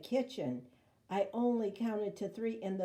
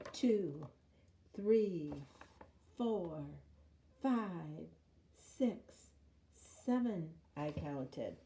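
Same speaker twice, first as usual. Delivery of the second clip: plain reading voice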